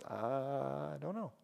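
low, soft, drawn-out admission of cluelessness; unsure Moses. Acted. Preacher as Moses answering the worried people, hesitant and unsure. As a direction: play Moses at a loss, slowly and under the breath. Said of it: "Put yourself in Moses' shoes".

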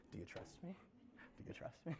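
breathy whispered question. Acted. God asking the listener, whispered. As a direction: whisper it intimately.

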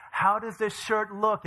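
high, slow show-off question; playfully showy reveal; grand entrance. Retold or imitated. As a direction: present it proudly, drawn out. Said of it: "this is the grand entrance".

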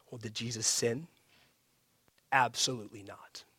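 dropped to a near whisper; hushed. Real, not acted. Pastor hushes the congregation with a whispered question. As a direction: lean in and whisper it.

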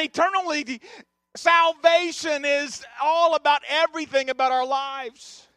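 loud, driving pulpit delivery; impassioned sermon, building by repetition; full-force preaching. Real, not acted. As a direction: hammer the point home to the congregation.

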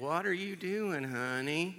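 put-on slow, drawn-out deep Alabama accent; Miss Francis's Alabama accent. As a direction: imitate an old lady's Southern drawl from Alabama. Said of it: "she had this deep Alabama accent".

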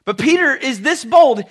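normal talking voice

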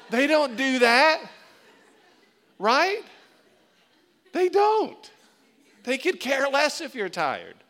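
preacher's rising emphatic shout; emphatic preaching. Real, not acted. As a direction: hammer the point home to the crowd. Emphatic preaching, higher and louder.

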